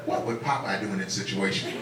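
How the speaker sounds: low, hushed inner voice; thinking to myself. Retold or imitated. Comic facing an irate boyfriend, thinking to himself. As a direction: drop low and soft to ponder. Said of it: "I start thinking to myself".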